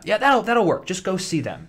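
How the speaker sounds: high loud upbeat agent; cheery agent voice. Retold or imitated. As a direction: chirp it like a breezy agent. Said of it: "They're like".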